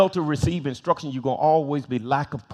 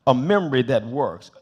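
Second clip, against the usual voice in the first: ordinary talk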